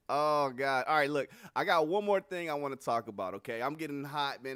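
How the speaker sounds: ordinary talk, nothing marked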